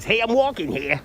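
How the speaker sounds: pushy, playful New York impression; Ma's voice. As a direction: do the movie line in a brash New York voice. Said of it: "Your favorite Ma's voice".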